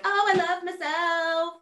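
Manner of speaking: mock-sung I love myself; fake self-love. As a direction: sing it like hollow self-affirmation. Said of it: "not just be like".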